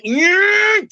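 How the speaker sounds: high anguished yell; anguished cry. Retold or imitated. Grieving father crying out, high raw yell.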